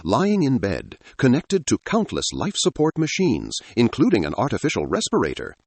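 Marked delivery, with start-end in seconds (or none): none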